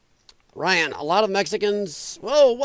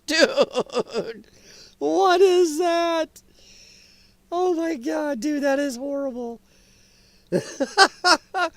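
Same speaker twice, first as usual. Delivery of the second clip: drawn-out words through laughter; real laughing, voice climbing high as words stretch; helpless laughter; 5 semitones higher, much slower than usual. Real, not acted. Reacting to a harsh, horrible joke, laughing helplessly.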